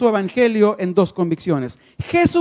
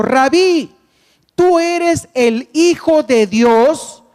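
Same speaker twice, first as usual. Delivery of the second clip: proclaimed confession; 6 semitones higher, 5 dB louder, much slower than usual. Acted. Preacher proclaims Natanael's confession.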